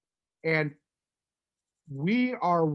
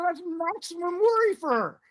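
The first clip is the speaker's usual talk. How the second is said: excited pitch; 13 semitones higher than usual. Retold or imitated. Pitching the move to John.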